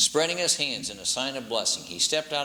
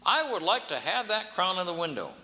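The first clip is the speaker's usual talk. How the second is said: haughty king; 7 semitones higher than usual. A narrator's reading. King demands crown.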